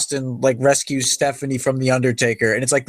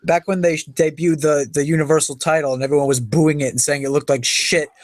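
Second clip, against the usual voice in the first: ordinary talk, nothing marked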